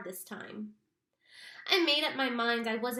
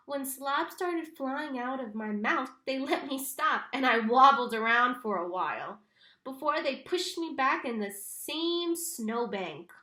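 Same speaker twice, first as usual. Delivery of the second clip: ordinary delivery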